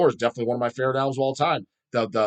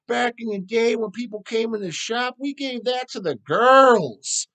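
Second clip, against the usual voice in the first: much higher, put-on bro voice, scoffing; mocking dumb metal bro; 9 semitones higher than usual. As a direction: play a smug brutal-metal bro waving it off. Same bro impression continues.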